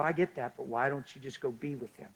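usual speaking voice

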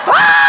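high, loud shriek of fright; sudden mock scream; startled scream. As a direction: yelp like a waitress caught off guard. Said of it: "She's gonna be like".

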